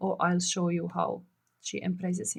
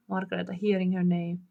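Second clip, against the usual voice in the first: normal talking voice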